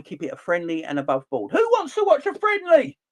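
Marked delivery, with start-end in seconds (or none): 1.5-3.0 who jumps high and loud; incredulous high. Real, not acted. Ranting podcaster asks listeners who wants to watch, incredulous and high. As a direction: fling out WHO in disbelief. Repeats question, first take raised.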